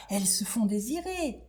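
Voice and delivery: whispered secret; whispered aside. Acted. Worm whispering advice to heartbroken scarab. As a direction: whisper it knowingly. Worm confiding about butterflies.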